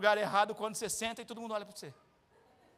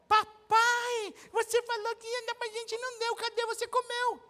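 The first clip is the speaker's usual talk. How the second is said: put-on high complaining little girl; child's voice; 10 semitones higher, slower than usual. Preacher voices daughters scolding their father, high childish whine.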